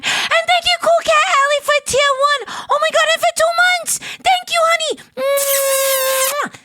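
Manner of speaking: very high squeal of gratitude; excited thanks. Real, not acted. Streamer thanking a subscriber of two months, excited squeal. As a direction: squeal the thanks right up top.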